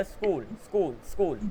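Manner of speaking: स्कूल run together, the s cut short; short breath. Shown as a demonstration. Teacher models it for a student, short-breath स्कूल. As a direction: say स्कूल with a short s straight into कूल. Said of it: "सांस को छोटा कर दे जाओ".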